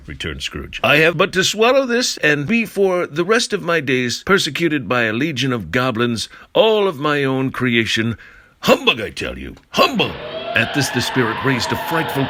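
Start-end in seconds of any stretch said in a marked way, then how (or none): 0.8-10.1 high, loud indignant scoffing; a flustered old miser shouting humbug; scoffing outburst. Acted. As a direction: blurt it out to mock away the fear. Scrooge scoffs at the ghost.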